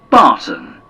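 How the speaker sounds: ordinary delivery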